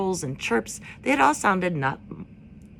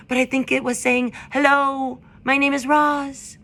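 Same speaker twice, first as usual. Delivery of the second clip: higher, louder owl addressing a crowd; hooting owl; 8 semitones higher, 6 dB louder than usual. A narrator's reading. Owl calling the meadow crowd to order, higher and louder. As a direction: hoot it out from the branch. Swooper voiced.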